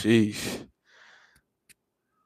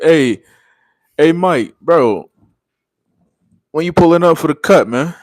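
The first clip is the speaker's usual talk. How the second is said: loud, higher hype call; 6 semitones higher, 6 dB louder than usual. Real, not acted. Calling out to a viewer.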